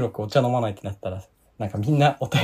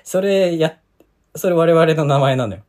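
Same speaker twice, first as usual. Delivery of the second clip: loud, laughing objection; laughing protest; 5 semitones higher, 8 dB louder, slower than usual. Real, not acted. Protests, laughing, name stolen.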